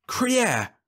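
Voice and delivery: very high startled exclamation; shocked cry. Acted. Rice stunned by Leffler's plan, shocked cry. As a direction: blurt it in shock.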